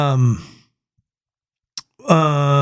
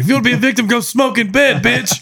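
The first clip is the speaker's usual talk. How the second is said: 1 mock instructor taunt; 8 semitones higher than usual. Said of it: "I heard you say something like".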